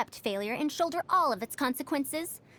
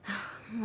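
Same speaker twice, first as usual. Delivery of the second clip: tired sigh; 3 semitones lower, 8 dB quieter than usual. Acted. Sigh before complaining about funding.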